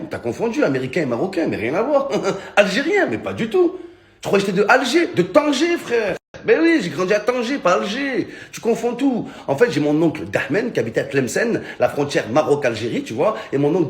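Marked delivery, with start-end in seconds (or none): 2.6-9.3 high, laughing mock voice; hammed-up fake Moroccan swearing he's from Tangier; mocking braggart.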